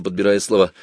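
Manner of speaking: ordinary delivery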